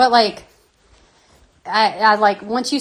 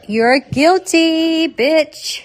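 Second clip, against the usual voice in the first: slow mock verdict; 8 semitones higher, 5 dB louder, much slower than usual. Mock accusing Mark, drawn out.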